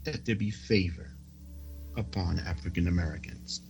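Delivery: ordinary talk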